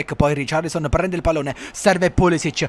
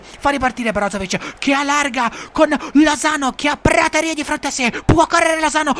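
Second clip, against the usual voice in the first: breathless italian goal commentary; 11 semitones higher than usual. Acted. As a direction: build then gasp at the miss. Missed chance.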